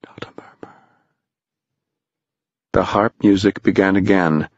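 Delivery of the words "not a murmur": barely audible whisper; very soft whispered warning; tiny mosquito whisper. A narrator's reading. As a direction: breathe it right into his ear. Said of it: "a mosquito in Tom's ear".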